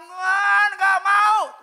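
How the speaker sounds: very loud, high, drawn-out protest; acted desperate yell. Believer resisting the fiery kitchen, acted desperate yell. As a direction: cry out to God in refusal. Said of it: "Saudara boleh teriak".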